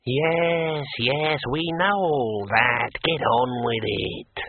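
drawn-out impatient ghostly whine; thin, peevish voice. A narrator's reading. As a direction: hurry them along in a thin, testy drone. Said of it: "said a thin, peevish voice".

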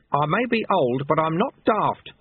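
mild, understated shout; indignant old master, lightly shouted. A narrator's reading. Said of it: "Lutze shouted".

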